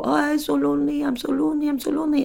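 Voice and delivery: imitating a high, plaintive lament on one level; lonely neighbour. Neighbour moaning at the door about loneliness, high mock lament. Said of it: "and she would go".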